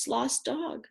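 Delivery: usual speaking voice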